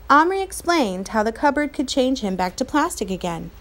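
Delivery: ordinary delivery, nothing marked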